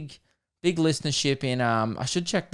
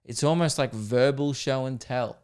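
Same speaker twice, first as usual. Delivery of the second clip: usual speaking voice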